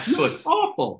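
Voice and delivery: high, exaggerated horror; mock shock. Crew greets the wrecked producer with high mock shock. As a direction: gasp it out high, half-hearted alarm.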